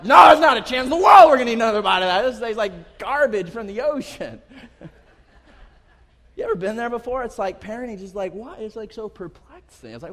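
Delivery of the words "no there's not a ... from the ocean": high, fast, shouted protest; mock outraged refusal. Kids refusing dad another bite, outraged shouting. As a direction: explode like a kid refusing food.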